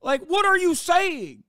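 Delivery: can't believe what you're hearing; exasperated what are you saying; incredulous. Real, not acted. Incredulous reaction to critics.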